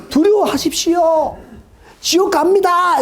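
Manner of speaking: high, slow ominous warning; mock fearmonger. Preacher parodying a fire-and-brimstone warning, slow and high. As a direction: ham up a doom preacher. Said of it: "이거를 지금 왜 이렇게".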